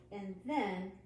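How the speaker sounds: ordinary delivery, nothing marked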